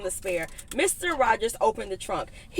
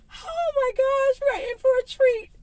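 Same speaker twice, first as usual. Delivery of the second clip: gushing delight. Real, not acted. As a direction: savor the thrill of what's coming.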